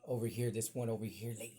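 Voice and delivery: ordinary delivery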